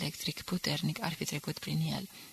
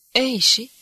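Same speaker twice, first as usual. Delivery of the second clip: higher, louder scoffing retort; dismissive shrug; 6 semitones higher, 9 dB louder than usual. Acted. Hermann shrugs off the threat of being shot, scornfully. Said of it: "ridică din umeri disprețuitor".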